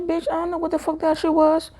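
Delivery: very high, exasperated retort. Retold or imitated. Girl snapping back at classmates, high exasperated retort. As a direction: fire back, fed up. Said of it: "I said".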